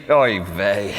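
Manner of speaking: low, weary Oy vey; mock exasperated Oy vey. Pastor imagining Jesus's exasperated reply to him, low. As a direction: sigh it out as a fed-up rabbi. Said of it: "Jesus would have answered me".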